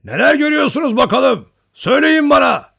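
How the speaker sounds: high, loud demanding call; shouting. A narrator's reading. Old hunter asking Cossacks what they see, shouting. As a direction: yell the question up to them. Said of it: "diye bağırdı".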